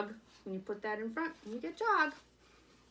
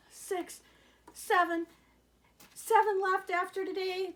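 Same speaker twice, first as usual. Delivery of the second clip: 1 rising excitement; 10 semitones higher, 5 dB louder than usual. Acted. Counting down last cards dramatically.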